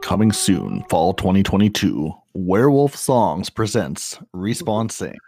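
trailer announcer. Acted.

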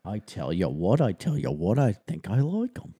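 hammed-up Cockney accent. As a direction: do a bad British chimney sweep.

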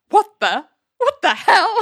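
high, rising mock outrage; shocked exclamation. Ali and Larry watch Elaine's make-out, shocked exclamation. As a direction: act out stunned disbelief, pitching up on the second try. Retelling the pair's shocked reaction.

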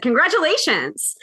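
ordinary talk

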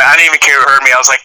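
usual speaking voice

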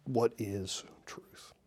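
whispered slowly, no voice at all; a slow whispered echo. Shown as a demonstration. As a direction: breathe the question out as a whisper. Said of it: "repeats the quote to let it sink in".

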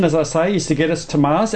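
ordinary talk

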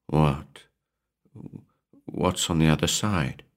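understated, hesitant nervous question. A narrator's reading. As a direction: ask timidly, pausing after the first word. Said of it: "Maurice asked nervously, trembling like a child".

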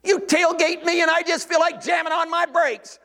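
high, strained cry of protest; acted inner outcry. Preacher voices his insides objecting to giving up anger, high exasperated outcry. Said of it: "my insides cry out and say".

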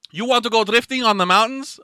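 high, loud put-on Japanese accent; mock Japanese accent. Man invites to go drifting, muddled mock Japanese accent. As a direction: pitch it up in hammed-up Japanese English. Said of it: "I don't even know what accent I'm doing".

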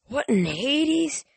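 high, slow, stretched disbelief; baffled exclamation. Acted. Annabeth finds they are caged.

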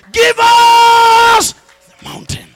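roaring rallying cry. Acted. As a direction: stretch it out as a battle cry. Climactic shouted demand.

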